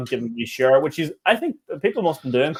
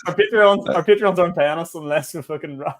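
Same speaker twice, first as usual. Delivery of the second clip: laughing; 5 semitones higher, 4 dB louder, slower than usual. Real, not acted. Laughing through Patreon plug.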